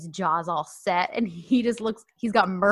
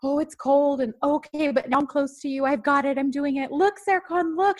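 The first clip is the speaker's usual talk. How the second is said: higher-pitched, eager retold chatter; excited swimmer; 5 semitones higher than usual. Host retells her showing off in the pool, excited. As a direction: call for his attention, excitedly.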